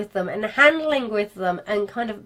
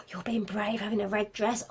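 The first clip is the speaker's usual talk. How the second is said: mock-shocked gasp, then a whispered aside; 6 dB quieter than usual. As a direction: gasp and whisper it like a thrilling secret. Acting a reply to the bride.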